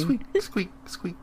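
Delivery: rapid run of high squeaks; squeaking imitation. Co-host mimics the chase by rats, high squeaking. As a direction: squeak like hurrying shoes and swarming rats. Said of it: "Can't you hear the squeaking?".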